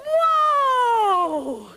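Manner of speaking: high, loud whoa reining in the horse; pony rider's whoa. Pretend. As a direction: pull up the pony with a big cry. End of pony ride song.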